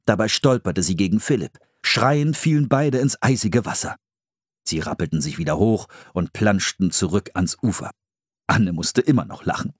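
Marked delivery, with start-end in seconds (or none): none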